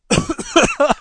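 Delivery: sharp cough clearing throat. Real, not acted. Cough after gravelly voice.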